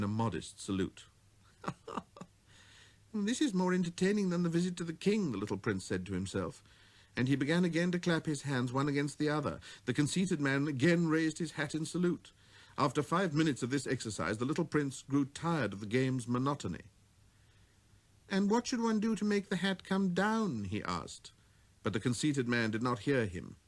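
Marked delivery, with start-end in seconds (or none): 3.1-5.3 put-on raised childlike voice; a small boy musing to himself; little prince. 18.3-20.6 put-on raised childlike voice; a small boy musing to himself; little prince.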